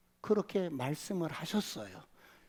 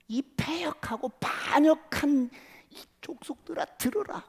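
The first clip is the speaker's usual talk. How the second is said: angry rebuke; 9 semitones higher, 6 dB louder than usual. Acted. Angry Moses rebukes people.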